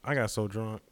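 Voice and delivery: ordinary talk, nothing marked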